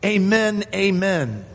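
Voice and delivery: "Amen, amen" high, then stepping down; emphatic. Retold or imitated. Preacher gives the doubled word behind the translation, emphatic. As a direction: ring out each "amen" to command attention. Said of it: "There's an emphasis here".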